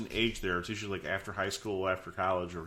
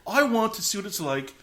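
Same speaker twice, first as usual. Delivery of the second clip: imitating Peter; 4 semitones higher, 5 dB louder than usual. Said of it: "he's like".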